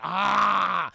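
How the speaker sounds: long drawn-out reveal yell; dramatic mock cry. Revealing to chat where the prisoner hides, mock cry. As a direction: hold a hammed-up cry of discovery.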